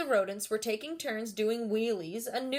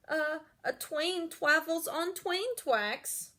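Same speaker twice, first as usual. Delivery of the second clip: miserable; 6 semitones higher, slower than usual. Acted. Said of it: "Wadney replied miserably".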